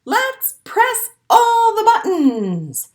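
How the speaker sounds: put-on high, loud, slow excited baby voice; eager baby penguin. Baby penguin jumps on the camera, excited. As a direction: squeal it like a tiny chick. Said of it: "A baby penguin jumps".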